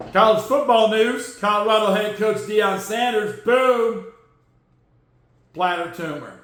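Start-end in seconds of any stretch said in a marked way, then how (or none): none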